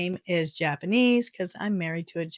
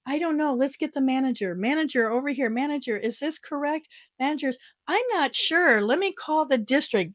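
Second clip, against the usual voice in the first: high, dithering voices; mock staff passing it up the chain; clueless clerks; 6 semitones higher than usual. Clerks passing buck to manager.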